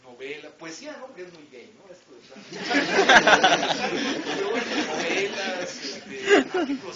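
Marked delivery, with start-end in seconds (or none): 2.5-4.2 big loud laugh breaking out; loud burst of laughter. Real, not acted. 4.2-7.0 talking through loud laughter, pitch up; laughing through words. Real, not acted. Still laughing after own joke.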